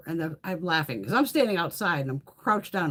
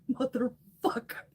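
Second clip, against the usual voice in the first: laughing curse; 7 semitones higher, 3 dB quieter, slower than usual. Retold or imitated. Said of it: "I'm like".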